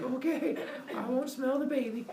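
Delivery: high, whiny giving-in; mock compliance. Kronos promising his wife, high mock-meek voice. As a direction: concede like a scolded husband, pitch up.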